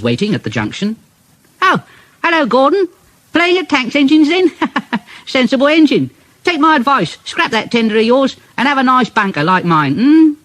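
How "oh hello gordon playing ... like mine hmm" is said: high-pitched, gleeful teasing; chirpy little engine, mockingly helpful; cheeky Thomas. A narrator's reading. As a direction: needle a bigger engine in a high, perky voice.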